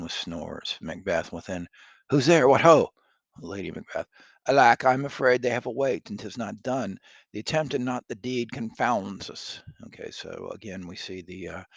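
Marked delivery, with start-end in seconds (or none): 2.1-2.9 loud, high stage call. Acted. Macbeth calls out. 4.5-9.6 dramatic Shakespeare reading. Acted. Lady Macbeth alarmed.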